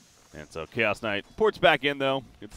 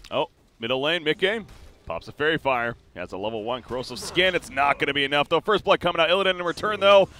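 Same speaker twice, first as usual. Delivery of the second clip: loud, higher-pitched esports commentary; excited play-by-play; 6 semitones higher, 8 dB louder than usual. Real, not acted. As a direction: call the kill as it happens, voice raised.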